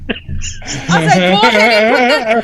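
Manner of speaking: talking through laughter; laughing. Real, not acted. Telling how he held back a laugh, laughing. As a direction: tell it while cracking up.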